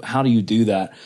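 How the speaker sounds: ordinary talk, nothing marked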